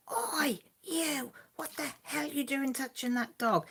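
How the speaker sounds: high-pitched mock telling-off, no real volume; retold shout. Wife jokingly shouts at Grant for touching a dog, mock outrage. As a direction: act out an outraged call without yelling. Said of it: "and I just shout".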